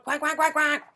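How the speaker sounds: high, frantic duck quacks; rapid string of high quacks; quacking ducks. Acted. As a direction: keep quacking over the traffic. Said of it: "kept right on quack".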